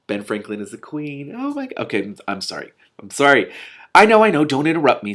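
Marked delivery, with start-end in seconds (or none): 4.0-5.1 testy, raised and louder. A narrator's reading. King cutting off the queen, testily. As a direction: snap it out, impatient king. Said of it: "said the king testily".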